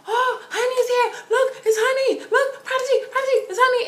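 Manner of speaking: very high, loud squeal; excited announcing. Retold or imitated. Friend telling Prodigy Honey is here, excited squeal. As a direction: imitate a friend squealing for his attention.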